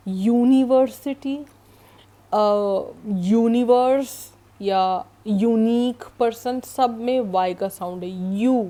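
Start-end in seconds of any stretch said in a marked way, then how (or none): 0.1-1.4 यूनिवर्सिटी with a loud, clear "you" at the start; stressed U sound. Shown as a demonstration. Teacher giving example words, यूनिवर्सिटी with its "you" onset pushed louder. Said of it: "give you that U sound". 3.3-4.1 यूनिवर्स with a loud "you" onset; stressed U sound. Shown as a demonstration. Teacher giving another example, यूनिवर्स opened on a strong "you" sound. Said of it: "give you that U sound".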